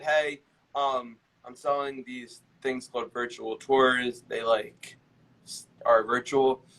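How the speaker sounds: slow, halting pitch full of hesitation; dragging, uncertain cold call; unsure sales pitch. Shown as a demonstration. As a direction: fumble the pitch as if unconvinced. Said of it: "imagine if you called and said".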